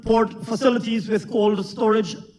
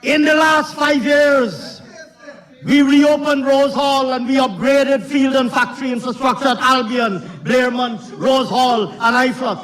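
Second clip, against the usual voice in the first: raised, emphatic political declaiming; forceful parliamentary oratory; 5 semitones higher, 3 dB louder than usual. Real, not acted. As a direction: project each phrase across a noisy chamber.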